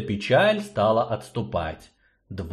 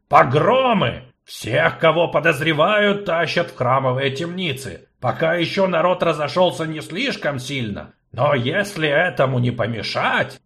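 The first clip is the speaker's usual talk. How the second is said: louder, urgent gnome; angry gnome, loud; 6 semitones higher, 4 dB louder than usual. Acted. Gnome urges sorceress to act, angry. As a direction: gnome pressing the point.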